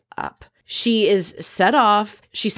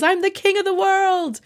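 much higher pitch, sung-out and jubilant; mock triumphant cry; 12 semitones higher than usual. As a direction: crow it like a mock victory cry. Acts out triumphant movie-line shout.

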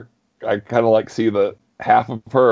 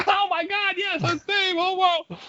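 delighted; 3 dB quieter than usual. Retold or imitated. Coworkers reacting delighted.

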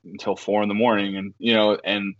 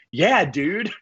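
higher casual mock voice of her; lightly voiced laid-back woman; imitating Meredith; 6 semitones higher than usual. Meredith answering his call back, lightly mocked. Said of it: "she was like".

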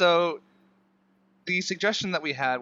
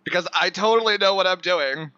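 sarcastic confidence; 5 semitones higher, 6 dB louder than usual. Real, not acted. Joking about being put on spot.

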